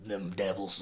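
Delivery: very low, quiet Texas menace; menacing Texas sheriff. Retold or imitated. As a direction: mutter it low like a threatening Texas lawman. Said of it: "slow, menacing tone of voice".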